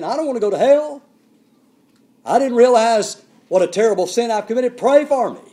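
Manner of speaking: pleading Simon. Retold or imitated. Simon begs Peter for prayer, high and pleading. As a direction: beg Peter to pray for you. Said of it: "That's what he's saying".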